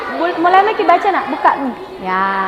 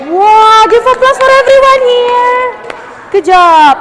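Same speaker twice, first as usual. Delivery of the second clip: cheering praise; 5 semitones higher, much slower than usual. Real, not acted. Teacher calls for applause for everyone, high excited cheer. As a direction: call out slowly to rally applause.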